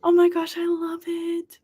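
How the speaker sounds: high, stretched, cooing "love"; gushing. Real, not acted. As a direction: let the words melt with delight. Reacting to viewer news.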